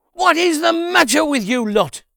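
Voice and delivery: loud, high, exasperated shout at the crowd; angry outburst. Acted. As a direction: bark it at the grumbling people. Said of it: "He was furious with the people".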